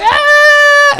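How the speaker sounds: very high, loud mock shriek; imitated screaming. Woman with water in her face, piercing imitation scream. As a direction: squeal like a woman just splashed. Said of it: "So she starts screaming".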